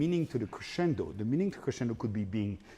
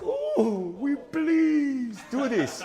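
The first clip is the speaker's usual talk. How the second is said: higher, slow pleading line; mock pleading; 12 semitones higher, 7 dB louder, much slower than usual. Shown as a demonstration. Coach acting out the plea behind the portando, slow and higher. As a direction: beg like you really mean it. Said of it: "So it's like".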